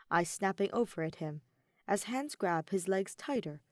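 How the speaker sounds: usual speaking voice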